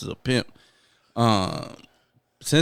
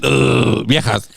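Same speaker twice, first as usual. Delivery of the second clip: mock caveman, grunted and lightly lowered; caveman imitation; 3 semitones lower, slower than usual. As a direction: grunt first, then a low caveman word. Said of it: "fucking caveman".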